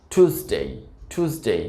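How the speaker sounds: American টিউসডে as "TOOS-day"; plain "too" opening টিউসডে; American pronunciation. Shown as a demonstration. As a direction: say টিউসডে with no y glide after t. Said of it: "টু plus এস".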